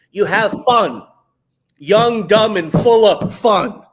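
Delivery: loud, high, punched-out cheer; rousing hype. Real, not acted. Coach urging creators to be silly, loud hype. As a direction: belt it out to fire them up. Coach hyping extreme fun.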